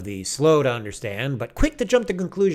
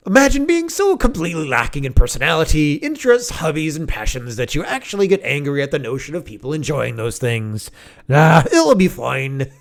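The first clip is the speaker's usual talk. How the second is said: Critical Drinker; 5 dB louder than usual. Acted. Reading out a Scottish YouTuber's scornful post, mock Scottish accent. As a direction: put on a hammed-up Scottish critic's voice. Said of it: "I'll try to say it in his voice as much as possible".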